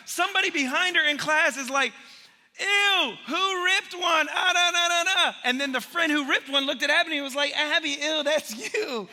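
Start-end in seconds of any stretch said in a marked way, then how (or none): 2.6-5.4 put-on high, loud grossed-out kid squealing; slow, squealing ew who ripped one; disgusted classmate. Classmate reacts to the fart. 7.6-9.1 higher, mock-disgusted accusation; sneaky friend faking disgust; blaming friend. Friend blames Abby.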